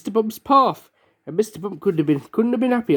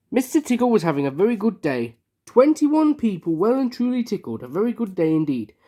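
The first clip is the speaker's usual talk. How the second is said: usual speaking voice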